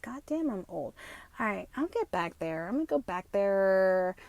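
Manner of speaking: normal talking voice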